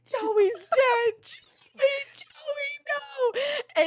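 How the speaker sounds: high-pitched wailing imitation of screamers; mock shriek thinning into a high wail; mock screaming. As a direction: ham up a crowd's horrified cries. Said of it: "everyone's screaming".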